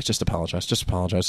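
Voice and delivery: hushed, low aside; quiet urging. Retold or imitated. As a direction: drop it low and soft, as a manager discreetly urging. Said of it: "like, quietly".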